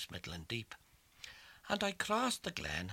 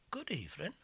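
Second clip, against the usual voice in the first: prim greeting; 7 semitones higher, faster than usual. Acted. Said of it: "smoothed her brat and says".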